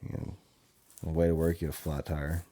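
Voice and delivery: ordinary talk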